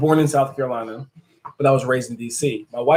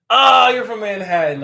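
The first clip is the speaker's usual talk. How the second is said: higher and louder, caught off guard; surprised higher exclamation; 8 semitones higher, 5 dB louder than usual. Real, not acted. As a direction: realize you guessed wrong. Learns the viewer's real borough.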